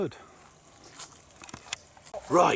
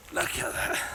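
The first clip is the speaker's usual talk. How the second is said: awed whisper; 5 dB quieter than usual. Real, not acted. Awed at huge caught fish.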